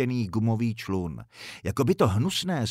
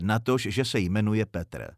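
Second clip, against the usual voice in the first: usual speaking voice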